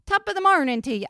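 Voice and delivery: a lightly hammed-up Irish accent, fast; a half-hearted stab at an Irish accent; bad Irish accent. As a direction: tries an Irish accent and fumbles it. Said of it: "can't do my Irish".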